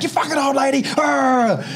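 put-on high furious yell at an old lady; road rage yell. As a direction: driver swearing at the old lady ahead. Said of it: "I'm like".

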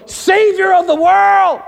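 high, slow, booming shout; big drawn-out triumphant cry; angelic proclamation. Acted. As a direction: herald it to the heavens.

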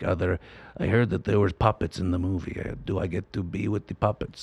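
low, soft old-actor impression; Walter Koenig. Imitating the actor asking to be with puppets, low soft voice. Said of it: "Ed Wood voice of Bela Lugosi".

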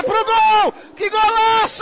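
rising into a loud goal call; goal shout. Real, not acted. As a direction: shout it as the ball hits the net.

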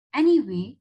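ordinary delivery, nothing marked

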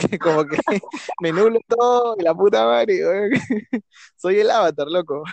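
giddy and laughing, carried away by the joke; excited laugh-talk. Real, not acted. Laughing with a friend about becoming the Avatar, giddy and loud.

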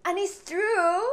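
high, loud laugh breaking through; bursting laugh. Real, not acted. Admitting to viewers her English is bad, bursting into laughter.

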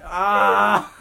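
sharp loud cry of pain. Real, not acted. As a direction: yelp from a stubbed toe. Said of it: "I just stubbed my toe".

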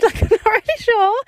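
high, laughing and unsure. Real, not acted. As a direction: laugh it off, a bit embarrassed. Laughing at her own uncertainty.

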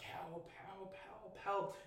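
high, small, held-back cry; mock screaming for help. Fleeing campers to farm villagers, mock scream for help. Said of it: "we just start screaming".

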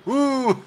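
high howled ouh like a wolf. Retold or imitated. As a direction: howl once like a wolf. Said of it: "fais le loup".

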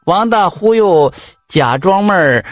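usual speaking voice

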